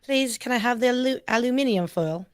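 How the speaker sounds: hesitant attempt at a British accent; imitated British accent. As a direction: try on British English, stumbling on aluminium. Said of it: "You gotta say it in a British accent".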